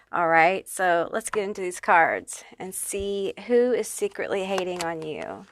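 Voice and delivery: ordinary delivery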